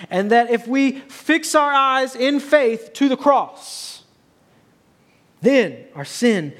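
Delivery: loud, high, driving sermon delivery; full-force preaching. Acted. Pastor to congregation, preaching at full force. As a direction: proclaim the verses to the whole church.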